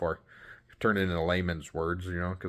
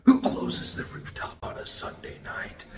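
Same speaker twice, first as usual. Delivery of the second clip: mock whisper; slower than usual. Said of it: "and I was like".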